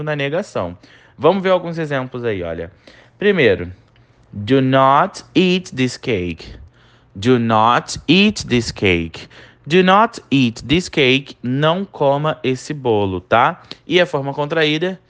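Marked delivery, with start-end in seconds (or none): 4.3-11.4 slow model reading. Shown as a demonstration. Teacher models English example slowly.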